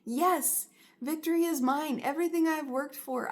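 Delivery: triumphant cheer. Acted. Viewer as Adonis claiming victory, triumphant and loud. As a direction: crow it like a winner. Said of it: "now you're Adonis and you're like".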